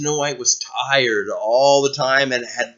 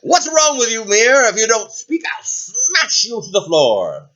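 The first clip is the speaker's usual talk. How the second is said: higher and louder, angry threat; mad queen; 8 semitones higher, 4 dB louder than usual. Acted. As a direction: snap at the mirror, then threaten. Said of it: "The queen got very mad and said".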